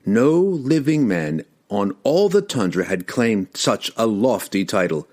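usual speaking voice

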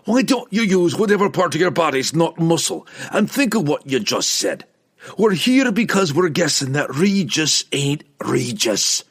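high, forceful dwarf's scolding; exasperated scolding. A narrator's reading. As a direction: tell him off for not thinking. Dwarf scolds the foolish barbarian.

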